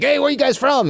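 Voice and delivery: high, eager hail to strangers; mock-eager stranger's greeting; friendly call-out. Acts friendly strangers calling out.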